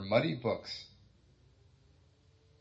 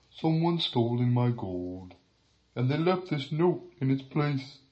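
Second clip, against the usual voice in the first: slow, lightly voiced upset dragon; a touch of dragon dismay; 2 semitones higher than usual. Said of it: "he cried".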